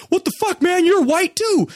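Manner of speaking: high-pitched mock outrage; offended protest. Redheaded kid to the storyteller after an insult, offended. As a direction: imitate an indignant kid, voice shot way up.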